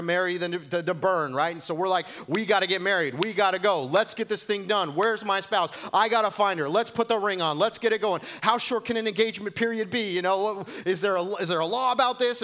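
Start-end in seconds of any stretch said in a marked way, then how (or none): 2.3-12.4 much higher, louder, breathless hurry; frantic rush. Retold or imitated. Pastor mocking churchgoers rushing into marriage, frantic. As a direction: panic to get married right now.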